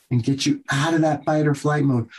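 normal talking voice

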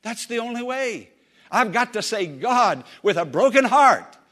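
loud, rising sermon voice; preaching at full force. Acted. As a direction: proclaim it to the congregation.

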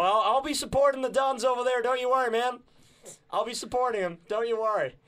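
mock American accent, playful; jokey American accent telling the host he'll back the Dons; put-on American accent. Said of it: "I'm gonna drop this accent".

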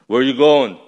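loud official questioning; brusque border officer. Retold or imitated. CBP guy questions the arriving boy, brusque. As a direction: demand it like a border guard.

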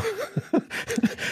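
high squeaky burst of laughter. Real, not acted. Laughs after the story.

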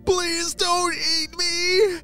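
high, drawn-out terrified plea; frightened shout. A narrator's reading. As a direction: beg the monster in a high-pitched wail. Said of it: "shouted Derek".

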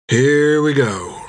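slow, resigned mutter with a sigh; the tired friend about to jump in anyway; slow, half-grinning resignation; weary mutter. Acted. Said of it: "muttered Tan with a sigh".

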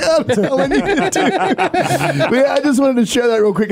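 high laughing voice. Real, not acted. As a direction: laugh while talking. Laughing after Stockholm joke.

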